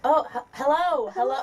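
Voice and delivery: high, loud, stumbling "hello", caught and redone; self-correcting greeting. Retold or imitated. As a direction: falter on "hello" while correcting your own accent. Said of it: "trying to say it how I usually say it".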